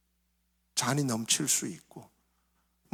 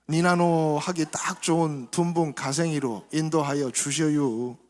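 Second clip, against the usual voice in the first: unhurried Chungcheong dialect psalm reading. Acted. Pastor reading Psalm 23 aloud in Chungcheong dialect. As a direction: read it easygoing, in Chungcheong dialect. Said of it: "이제 충청도 버전 한번 보면".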